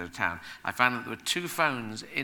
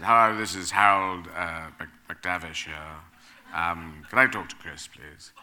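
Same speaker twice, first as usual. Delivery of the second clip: deliberately bad, lower put-on agent voice; bad agent impression; 6 semitones lower, 4 dB louder than usual. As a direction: fake a grand agent on the phone, pitched lower. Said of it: "a bad impression of him".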